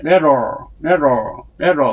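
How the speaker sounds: 레로 with the tongue curling back at the end; 레로 demo. Shown as a demonstration. Teacher repeating 레로 to show the R ending. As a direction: say 레로, curl the tongue in. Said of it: "레로 하고 나서 혀가 안으로".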